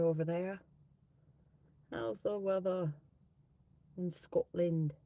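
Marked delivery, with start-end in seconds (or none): 1.9-4.9 put-on halting, half-successful Scottish accent; tentative stab at a Scottish accent; attempted Scottish accent. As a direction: try a Scottish accent, dropping the h. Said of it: "do, like, a Scottish accent".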